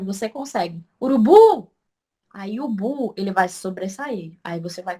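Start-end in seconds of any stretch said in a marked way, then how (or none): 1.0-1.7 calling out. Shown as a demonstration. Teacher shows students how to shout for the word, calling out. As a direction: shout the word, long loud high stress on the final "bu" of Urubu. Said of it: "grita pela palavra".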